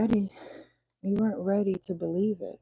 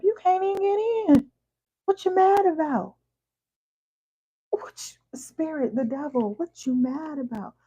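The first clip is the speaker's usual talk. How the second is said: mock God, higher and louder, sassy; hammed-up God talking back, pitched up and loud; voicing God; 7 semitones higher, 6 dB louder than usual. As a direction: play God scolding the client, higher and louder. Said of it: "God said".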